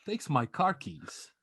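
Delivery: ordinary talk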